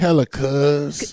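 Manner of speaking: slow, drawn-out Bay Area slang; mock Bay accent. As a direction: stretch out hella cuz like an Oakland guy. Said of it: "the epitome of the Bay accent".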